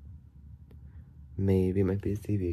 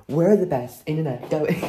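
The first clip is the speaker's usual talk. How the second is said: mock advert boast; 6 semitones higher, 4 dB louder than usual. Mocking the internet company.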